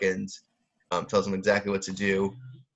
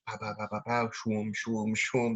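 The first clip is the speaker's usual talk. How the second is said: quick soft pows, then airy whooshes; punch sound effects; 6 dB quieter than usual. Shown as a demonstration. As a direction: softly imitate jabs and swooshing punches. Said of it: "That's how a punch sounds as it's thrown".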